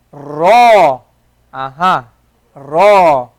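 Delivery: heavy ra, low; ra modelled. Shown as a demonstration. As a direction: recite a full ra. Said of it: "Notice we didn't say".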